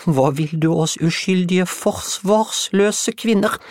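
very high, loud, slow whimper of fright. Acted. Juliette seeing a floating woodpile, whimpering in mock terror. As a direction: wail in hammed-up terror.

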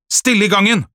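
high, loud teacher's command; stern order. A narrator's reading. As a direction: call out strictly down the corridor. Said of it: "sa læreren strengt".

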